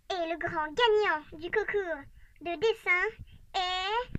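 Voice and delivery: slow, deliberate announcer build; slow drumroll build. Acted. Announcing the grand winner, slow suspenseful build-up. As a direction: hold the suspense word by word.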